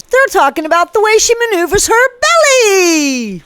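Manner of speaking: high, strained put-on voice; mock exclamation. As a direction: send it up high, stretching the last word. Mock emphatic outburst over belly rumours.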